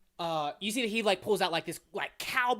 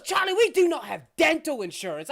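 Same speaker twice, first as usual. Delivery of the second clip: high, loud protest; acted indignation; 7 semitones higher, 7 dB louder than usual. Brother refusing Charlie's rock-hard cookie, indignant protest. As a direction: object loudly, in mock outrage.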